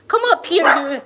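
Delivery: high, loud call to the dog. Real, not acted. Owner calls her dog up to her, loud and high. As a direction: coax the dog up with a bright shout.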